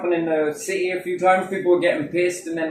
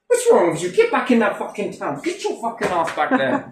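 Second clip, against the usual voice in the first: raised-pitch, half-serious telling-off; mock scolding; 7 semitones higher than usual. Camper ordering a cop back to town, mock indignant scolding. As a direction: send them packing in mock indignation.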